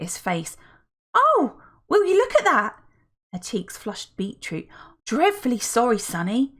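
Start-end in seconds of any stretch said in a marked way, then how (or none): 1.1-2.8 high, bright, gushing lady voice; chatty older woman. Acted. Felicity greets them, chatty aunt. 5.1-6.5 a hint of a gushing lady; lightly voiced fussy woman; chatty older woman. Felicity apologises.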